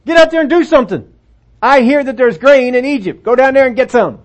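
a father shouting his sons into action; exasperated dad barking orders; scolding father. Acted. Dad comes out.

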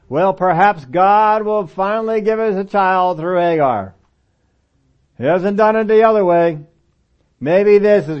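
Abraham's doubt, loud and slow; hesitant patriarch talking himself into a shortcut. Retold or imitated. Abraham voiced doubting Hagar plan.

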